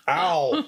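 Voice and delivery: sharp high yelp of pain. Real, not acted. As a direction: yelp from a burnt tongue. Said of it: "It just burnt my tongue".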